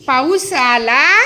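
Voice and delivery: पाऊस आला very slow with a rising end; drawn-out prompt. Shown as a demonstration. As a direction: drag out पाऊस आला to cue धो धो धो. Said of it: "prompting the answer". (ताणून विचारलेला प्रश्न)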